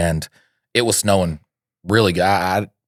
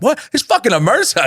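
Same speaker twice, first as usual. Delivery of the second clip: much higher, mocking disbelief; mocking friend; faster than usual. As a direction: squeak out his outrage in a high mocking voice. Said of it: "his other friend was like".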